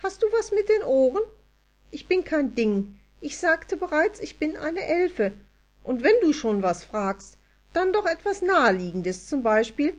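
insulted elf correcting the rude human; offended elf. A narrator's reading. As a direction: snap back like a miffed tiny elf. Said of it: "Das Wesen sah beleidigt aus".

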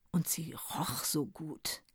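soft, slow breathy whisper. A narrator's reading. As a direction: whisper it dreamily, slowly. Dreamy narration about Vivi.

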